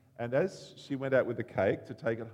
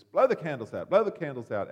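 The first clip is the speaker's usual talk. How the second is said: a touch of a gruff cafe owner, louder; 3 semitones higher, 9 dB louder than usual. Retold or imitated. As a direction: bark it out lightly like a rough cafe owner. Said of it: "is a bit gruff in his manner".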